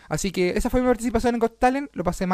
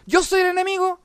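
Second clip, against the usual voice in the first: high-pitched crazed mock yell; imitating your own frantic outburst; retold shouting; 12 semitones higher, 3 dB louder than usual. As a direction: chant it like a worked-up madman, pitch way up. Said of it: "el loquito gritando".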